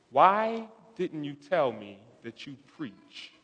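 soft, slow, wounded-sounding question; quietly retold reproach; hushed retold question. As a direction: imitate her quietly, drawing out "why". Retells woman confronting him.

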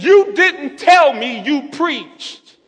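high, loud, slow imitated outcry; mock her upset, stretching each word; retold tearful reproach. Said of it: "So she's crying now. She's crying".

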